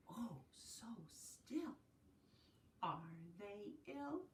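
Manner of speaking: slow unvoiced hush; whispered wonder. Teacher whispering over sleeping cows. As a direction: whisper so the cows stay asleep. Hushed song line.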